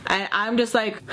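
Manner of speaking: usual speaking voice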